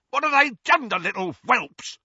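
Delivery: high, loud screaming. A narrator's reading. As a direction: scream in fury and fear. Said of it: "he screamed".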